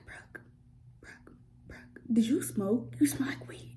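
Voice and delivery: hushed, secretive whisper; whispered tip-off to a friend; urgent whisper. Retold or imitated. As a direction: lean in and whisper a warning. Girl warning her quietly in hallway.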